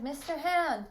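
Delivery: high, loud calling voice; boy's acted shout. Boy re-enacting his shout to the visitor, loud. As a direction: call out the name as a boy would. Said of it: "and I shouted".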